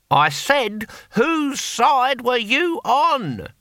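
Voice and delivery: high, slow shouted question; exasperated repeat. Acted. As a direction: bellow it word by word for deaf ears. Louder repeat for deaf Hamish.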